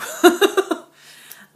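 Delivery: high, loud breathy laugh; burst of laughter. Real, not acted. Vlogger laughing at her own repeated phrase, loud burst of laughter. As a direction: laugh out at yourself.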